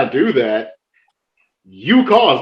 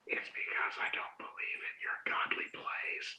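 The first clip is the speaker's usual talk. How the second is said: whisper; 23 dB quieter than usual. Retold or imitated. Taunting opponent in hushed aside.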